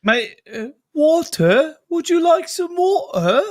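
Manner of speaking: high, questioning put-on British accent; mock British accent. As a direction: badly fake a British accent. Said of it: "You're Irish, not British ... can't do that accent".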